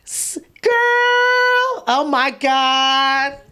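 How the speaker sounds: high, drawn-out hammed-up "girl"; exaggerated squeal, stretched right out; gushing mock greeting. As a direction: gush it slowly, imitating an overexcited American. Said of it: "You already hear there's something wrong".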